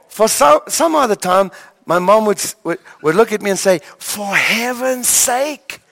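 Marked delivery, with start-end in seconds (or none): 4.0-5.8 high, long-drawn reproach; imitated motherly scolding, drawn out high; unfriendly exasperation. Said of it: "never a friendly face".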